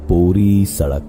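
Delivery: ordinary delivery, nothing marked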